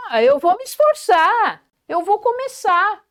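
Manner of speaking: voz mais aguda, cheia de iniciativa; animado, determinado. Shown as a demonstration. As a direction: diga com ânimo de quem vai tentar. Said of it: "Olha a diferença". (com entusiasmo e determinação)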